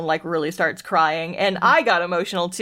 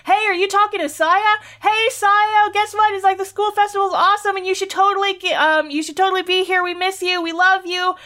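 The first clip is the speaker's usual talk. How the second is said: very high, eager chatter of schoolmates; classmates chiming in; 11 semitones higher than usual. Retold or imitated. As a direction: imitate excited friends piling into a voicemail. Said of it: "like, yelling all at once".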